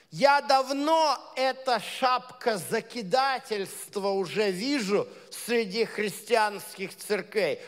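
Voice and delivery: ordinary talk, nothing marked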